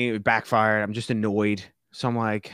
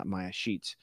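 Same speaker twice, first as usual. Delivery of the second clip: normal talking voice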